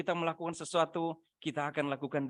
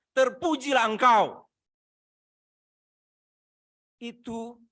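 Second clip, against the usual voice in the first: loud, high, fervent sermon delivery; full-force preaching; 10 semitones higher, 9 dB louder than usual. Acted. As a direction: preach it out to the whole church.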